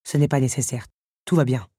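low, mild protest; protesting beast. A narrator's reading. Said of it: "protesta la bête". (bête qui proteste mollement, voix grave)

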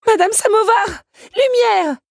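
high, full-voiced desperate yell; screaming for help. Young woman calls her allies for help, screaming. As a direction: scream it at the top of your lungs. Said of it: "s'égosilla-t-elle".

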